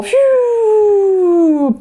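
long high-pitched whistling swoosh; wormhole whoosh. Acted. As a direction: imitate flying through a wormhole. Imitating flight through the wormhole. (模仿穿越蟲洞的高音咻聲)

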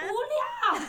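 high laughing scoff at the absurdity. Real, not acted. 朋友听到退税的问题，笑着嘲讽. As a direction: scoff through a burst of laughter.

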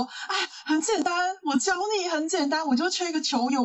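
high-pitched breezy coaxing, mimicking a pal; imitating encouraging friend. Friend talks the speaker into joining volleyball class, mock coaxing. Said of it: "做了很多正向的心理的建设跟支持".

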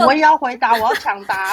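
loud eager interruption; eager shout. Real, not acted. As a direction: jump in loudly to answer first. Jumping in to answer.

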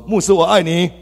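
high and loud, leading the crowd; loud declaration. Shown as a demonstration. Leading the congregation, high loud declaration. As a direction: call it out for everyone to repeat. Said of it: "来，大声讲啊".

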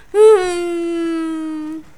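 high, loud whimpering wail; loud sob. A narrator's reading. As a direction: wail like a crying child. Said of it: "爱哭公主坐着哭".

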